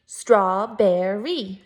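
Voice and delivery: Strawberry slowly split into three beats; syllable by syllable. Shown as a demonstration. Teacher counting syllables with first graders, slow and chunked. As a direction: break Strawberry into STRAW, BER, RY. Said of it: "Three syllables".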